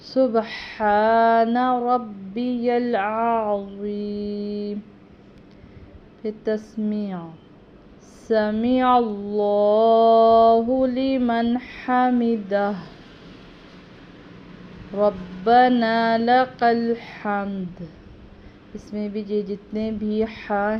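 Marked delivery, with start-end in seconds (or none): 0.0-4.8 سبحان with throaty ح, not سبحانہ, slowly chanted; correct سبحان. Shown as a demonstration. Teacher showing correct سبحان, slow chanted recitation. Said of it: "سبحانہ نہیں کہنا". 8.3-17.8 slow, drawn-out chant, louder; prayer recitation. Shown as a demonstration. Teacher demonstrating tasmi, slow louder chant.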